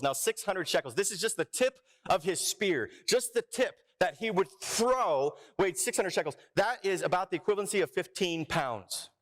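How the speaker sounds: normal talking voice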